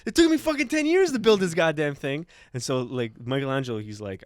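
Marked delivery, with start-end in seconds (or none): 0.1-2.2 loud, high-pitched disbelief; hammed-up indignant complaint; mock outrage. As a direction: protest like a slighted artist. Voicing Michelangelo outraged at being bested.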